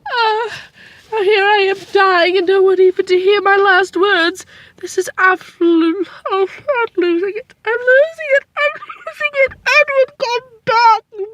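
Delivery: high, hammed-up dying lament; melodramatic dying wife. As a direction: deliver last words like a tragic death scene. Dying wife's melodramatic last words.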